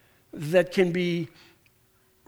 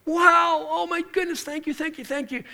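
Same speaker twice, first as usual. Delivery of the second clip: a forgiven debtor, amazed and grateful; high, breathless wonder then fading thanks; overwhelmed gratitude; 10 semitones higher than usual. Retold or imitated. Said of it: "And the guy's like".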